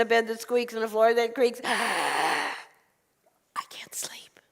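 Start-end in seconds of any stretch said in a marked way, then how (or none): 1.6-4.4 sighing, very slow whisper; exhausted complaint. Acted. Old woman complains to the rabbi, exhausted sigh.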